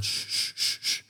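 unvoiced shushing, imitating kids. Kids sneaking past the stage, whispered shush. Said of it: "they're going".

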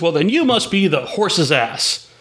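drawn-out mock-announcer punchline; clown's punchline. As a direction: land the gag like a clown playing to the crowd. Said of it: "the clown looks at him and he says".

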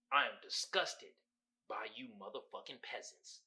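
low, quiet disgust reading a meme. Acted. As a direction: mutter it with deep disdain. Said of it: "he looking disgusted".

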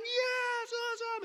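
high, delighted yes; excited cheer. Retold or imitated. Crowd celebrating Tommy's grab, high and delighted. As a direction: celebrate the jump.

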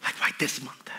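breathy whispered question to God; a hushed plea whispered to God. Retold or imitated. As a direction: whisper it like a private, desperate prayer. Pleading prayer to God, retold.

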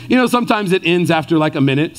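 normal talking voice

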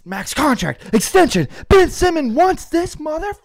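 mock arena intro climbing high; hammed-up countdown announcer. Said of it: "we do P A announcer voices".

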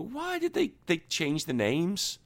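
soft, very high squeak; quiet high-pitched mock complaint; mock bewildered squeal. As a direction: pipe up in baffled falsetto. Mock complaint about renamed game.

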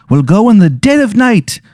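sly squeaky leprechaun tempting the lad. Acted. Leprechaun plots the heist.

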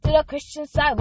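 high, loud rallying call; host counting in the celebration chant, pumped; hype count-in. Real, not acted. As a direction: rev up the crowd before the song.

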